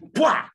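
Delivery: loud high explosive "Pwah"; sharp burst. Acted. Preacher to the congregation, sudden loud burst. Imitated sound of sudden interruption.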